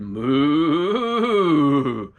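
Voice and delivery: big, swelling, drawn-out moo; full-voiced pretend cow call; imitated cow moo. A narrator's reading. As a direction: moo long, rising then falling. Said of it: "And Jack said".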